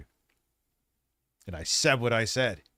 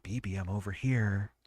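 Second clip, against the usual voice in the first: mock pleading in a silly put-on voice; put-on begging sellout voice; 2 semitones higher, 4 dB quieter than usual. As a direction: plead like a shameless sellout, hushed and rising. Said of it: "Why am I talking like this? Jeremy doesn't talk like this".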